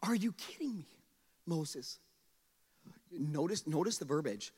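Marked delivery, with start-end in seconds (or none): 0.0-2.0 whispered incredulous aside; hushed disbelief. Acted. Preacher reacts to Moses refusing God, hushed disbelief. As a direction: drop to a near whisper.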